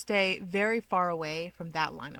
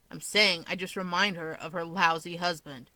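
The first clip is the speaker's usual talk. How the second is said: ordinary delivery, nothing marked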